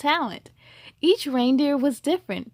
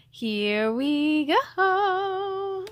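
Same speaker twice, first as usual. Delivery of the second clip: very slow stretched here we go; drawn out; 6 semitones higher, much slower than usual. Acted. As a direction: stretch every word out. Drawn out start.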